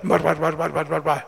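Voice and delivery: low, rough babble mimicking a foreign tongue; mock barbarian gibberish in a low monotone; imitating barbarian speech. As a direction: drop low and chant meaningless syllables like barbarian talk. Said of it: "their language sounded like".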